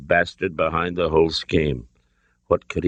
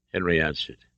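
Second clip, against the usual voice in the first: usual speaking voice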